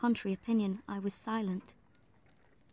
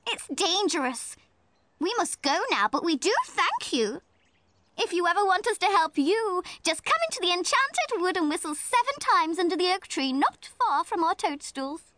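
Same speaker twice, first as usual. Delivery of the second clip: a tiny helper elf, prim and kind; huffy little helper elf; 8 semitones higher than usual. Acted. Brownies speak to the children.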